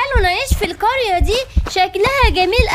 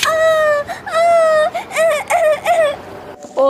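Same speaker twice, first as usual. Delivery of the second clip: cries of pain; 6 semitones higher, much slower than usual. Acted. Gazelle hit, crying in pain.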